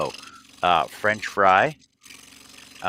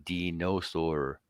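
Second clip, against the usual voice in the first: slow, drawn-out "Dinosaur."; slow deadpan; 2 semitones lower, 8 dB quieter, much slower than usual. Retold or imitated. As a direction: drag out "Dinosaur." flatly. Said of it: "And Jason's like".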